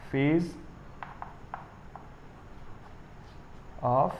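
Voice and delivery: very slow, halting word-by-word delivery; slow dictation. Real, not acted. Teacher writes the corrected definition for students, slow dictation. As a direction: dictate at handwriting speed, pausing after each word.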